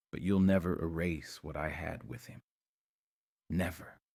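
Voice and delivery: subdued, quietly resolute; steady through tears. A narrator's reading. Will defends his love to Rick, quietly steady. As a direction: state it firmly while holding back tears. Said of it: "his voice steady despite the tears".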